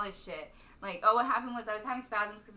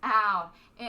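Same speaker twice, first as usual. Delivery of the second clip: labored breathing; 8 dB louder, much slower than usual. Retold or imitated. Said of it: "That's how I was when I was walking".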